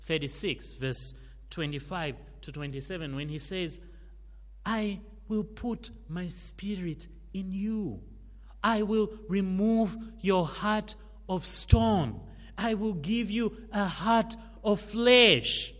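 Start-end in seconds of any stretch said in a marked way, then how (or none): 4.7-15.7 proclaimed scripture. Acted. Quotes Ezekiel, proclaimed.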